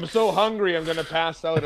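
hammed-up whine about being starving; mock whiny dieter. Mocking hungry people to his co-host, high whine. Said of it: "when people are like".

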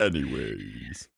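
deep, slow mock voice; low slow anyways; low drawn-out anyways. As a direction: drop the voice way low and stretch it. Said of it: "I can't do my voice that low".

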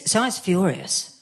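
ordinary talk

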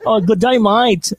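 high, put-on Australian greeting; mock Australian accent. Host joking with the singer in a mock Australian accent. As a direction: pitch up a playful Australian accent. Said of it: "Australian pala yun".

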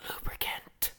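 ASMR whisper into the mic; sweet nothings. Acted. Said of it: "We are going to whisper sweet nothings".

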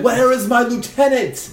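a hammed-up angry shout for the lieutenant; enraged demand. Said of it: "like, enraged".

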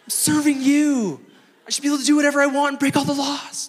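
high, sing-song entitled whine; whiny complaint. Retold or imitated. Pastor whining to God about the ticket, high sing-song whine. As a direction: plead like a spoiled kid.

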